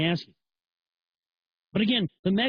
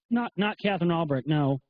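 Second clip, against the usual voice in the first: ordinary talk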